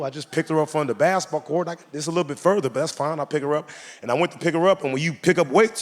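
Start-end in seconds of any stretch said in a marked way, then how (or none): none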